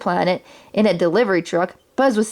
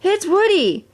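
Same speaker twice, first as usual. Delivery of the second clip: much higher, delighted yell; excited yell; 11 semitones higher than usual. Acted. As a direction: shout to the others, thrilled. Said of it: "yelled out to the other toys".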